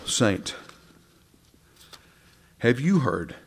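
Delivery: ordinary delivery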